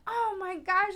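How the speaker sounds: high, mock-amazed reaction; imitated amazement. Owner learning her dog is found, imitated amazement.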